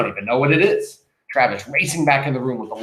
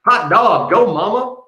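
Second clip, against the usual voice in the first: loud, high whooping cheer; whooping tease; 8 semitones higher, 5 dB louder, slower than usual. Acted. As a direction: crow it out, teasing mom. [name] laughing at the hat.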